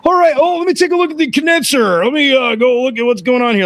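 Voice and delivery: high, overly breezy cover-up voice; feigned nonchalance. Retold or imitated. Technician coming out to look at the condenser, fake casual. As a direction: announce it high, as if nothing happened. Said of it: "as if nothing happened".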